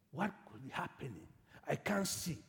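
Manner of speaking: murmured puzzlement of a blind man; quiet wondering. Acted. Blind Bartimaeus wondering at the noise, quiet wondering.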